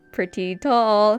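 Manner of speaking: "pretty tall" slowly stretched out; drawn-out emphasis. Real, not acted. Artist describing her very tall favorite character, drawn out. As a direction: draw out both words, lingering on "tall". Drags words, then repeats them.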